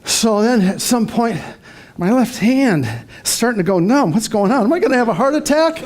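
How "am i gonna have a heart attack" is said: fast, laughing mock panic turning into a laugh; giggling at his own silly fear. Real, not acted. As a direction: chuckle through a fake worried question. Jokes about heart attack.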